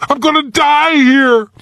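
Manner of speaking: put-on high, frightened boy's voice; boy stuck in ice, certain he will die; panic. A narrator's reading. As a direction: blurt it in panic. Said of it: "Now there was panic".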